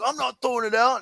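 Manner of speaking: high-pitched retold refusal; indignant refusal. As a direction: refuse outright, mock-indignant. Acts out friend's refusal.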